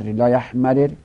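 لا یحمرر loud, with two separate r sounds; لا یحمرر as "la yahmarir"; unmerged form. Shown as a demonstration.

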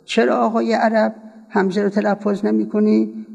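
ordinary delivery

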